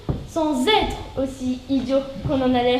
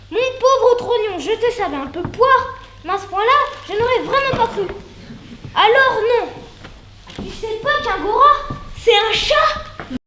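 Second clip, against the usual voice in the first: higher and louder, dragging the words out; comic character voice, mock pity; 7 semitones higher, 4 dB louder, slower than usual. Scolding her partner for not knowing what a gora is, high mocking voice. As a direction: talk down to a clueless friend.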